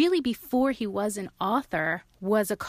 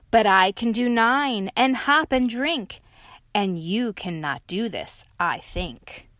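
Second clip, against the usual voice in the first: loud taunting boast; loud smug challenge; smug tiger; 2 semitones higher, 5 dB louder than usual. Acted. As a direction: dare them to match.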